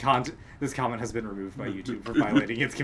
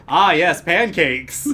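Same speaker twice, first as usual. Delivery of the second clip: loud, higher-pitched delighted announcement; mock soundtrack line; 5 semitones higher, 9 dB louder, slower than usual. Co-host voicing a headcanon cum shot soundtrack, hammy exclamation. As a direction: exclaim it loudly, relishing the joke.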